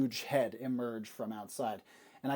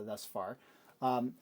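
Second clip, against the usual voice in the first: ordinary talk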